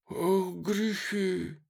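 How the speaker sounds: put-on high, slow old man's sigh; toothless old man. A narrator's reading. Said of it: "проговорил беззубый старик".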